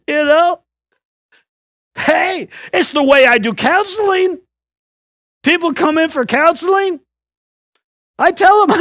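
ordinary talk

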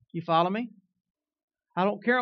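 ordinary talk, nothing marked

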